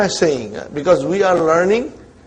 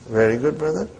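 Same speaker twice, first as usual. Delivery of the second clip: normal talking voice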